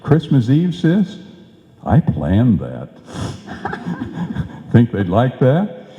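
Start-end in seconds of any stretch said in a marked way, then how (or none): 3.1-4.5 a high breathless chuckle; high airy laugh. Acted. Mister Boy laughs, pleased with his candy plan, high and airy. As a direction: squeak out a delighted laugh.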